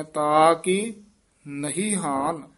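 ਤਾ ਕੀ, a held break, then ਨਹੀਂ ਹਾਨ; phrase split after ਤਾ ਕੀ; pause after ਤਾ ਕੀ. Shown as a demonstration. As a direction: rest on ਤਾ ਕੀ before going on. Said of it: "ਇੱਥੇ ਯਮਕ ਲਾ ਦੇਣੀ ਹੈ".

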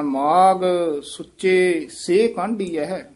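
ਮਾਗ, pause, ਸੁੱਚੇ, pause, then ਸੇ ਕਾਂਢੀ; slow phrasing with halts after ਮਾਗ and ਸੁੱਚੇ; separated by pauses. Shown as a demonstration. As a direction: keep ਸੇ with ਕਾਂਢੀ, apart from ਸੁੱਚੇ. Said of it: "ਇਉਂ ਉਚਾਰਨ ਕਰਨਾ ਹੈ".

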